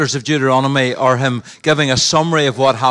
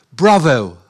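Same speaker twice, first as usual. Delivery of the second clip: 'Bravo' said the English way, falling; a proud, clipped English 'Bravo'; English Bravo; 4 dB louder than usual. Retold or imitated. God's word voiced in punchline. («Bravo» à l'anglaise)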